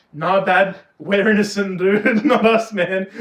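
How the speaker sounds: laughing denial. Retold or imitated. Kids deny to dad, laughing.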